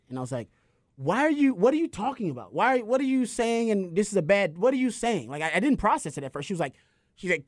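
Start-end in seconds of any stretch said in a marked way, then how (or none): 0.9-5.3 higher, confused retold questioning; a touch of shocked denial; disbelief. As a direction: struggle to accept the news. Retold disbelief at death news.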